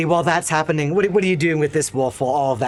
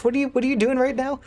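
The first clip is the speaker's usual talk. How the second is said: high, fast laughing question; laughing disbelief; 11 semitones higher, faster than usual. Real, not acted. GM laughing at player.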